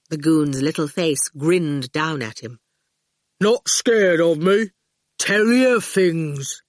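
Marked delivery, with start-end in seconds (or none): 3.4-6.6 enticing goon. A narrator's reading. The goon tempting Howard with secrets, slow and coaxing. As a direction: lure the boy in slowly. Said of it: "he said enticingly".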